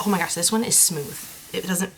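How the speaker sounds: normal talking voice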